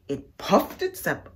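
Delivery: plain reading voice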